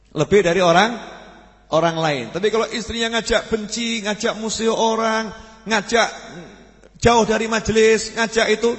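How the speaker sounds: emphatic preaching. Real, not acted. Preacher presses warning point. (khotbah keras dan menekan)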